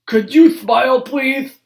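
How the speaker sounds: put-on high, loud old-man request; Grandpa asking. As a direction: ask the officer as toothless Grandpa. Grandpa asks officer.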